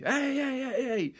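high shouted hey, hey from a distance; calling out. Retold or imitated. Cousin calls to him while running back, high calling shout. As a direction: call out high to get his attention.

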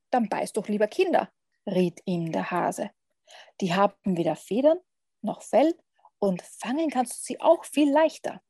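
measured read-aloud with long pauses; lively picture-book narrator, pausing between phrases; story narration. A narrator's reading. Podcast guest reads a vampire picture book to listeners, storytelling.

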